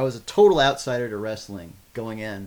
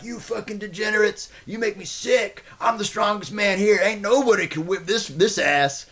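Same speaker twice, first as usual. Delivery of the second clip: high, intense heel wrestler promo; wrestling promo; 8 semitones higher than usual. Retold or imitated. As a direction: cut a pro wrestling promo at the crowd. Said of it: "I would cut a promo".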